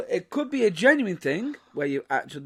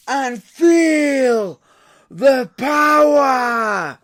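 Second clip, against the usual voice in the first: hype announcer shout; 5 semitones higher, 7 dB louder, slower than usual. Acted. Hyped show intro announcement.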